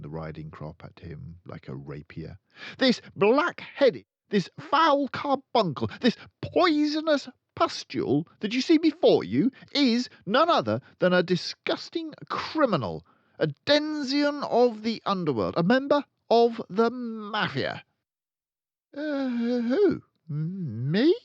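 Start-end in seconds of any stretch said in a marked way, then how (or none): 2.8-17.8 loud, much higher ranting insults; booming tirade. Acted. Headmistress denouncing the boy to the school, booming. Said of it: "boomed the headmistress". 18.9-21.1 high, startled squeak; puzzled. Acted. Boy accused in front of the school, puzzled. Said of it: "looking genuinely puzzled".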